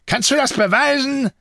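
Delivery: high, forceful challenge; angry interrogation. A narrator's reading. Jean challenges Antoine's alibi, angry interrogation. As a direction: demand proof sharply.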